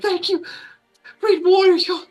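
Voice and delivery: high, loud adoring elf; high admiring elf woman. Acted. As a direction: gush with gratitude. Elf voice thanks warrior.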